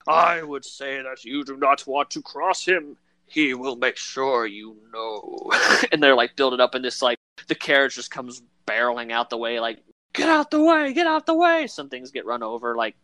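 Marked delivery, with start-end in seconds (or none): 0.1-5.5 first mate warning about the captain; ominous first mate. Retold or imitated. 10.1-11.7 much higher, louder frantic yell; mock shouting. Carriage driver shouting.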